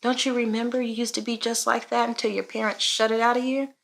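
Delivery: quiet, high voice of God, gently questioning; the Lord speaking. Retold or imitated. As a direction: remind someone tenderly, hushed and lifted. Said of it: "He was like".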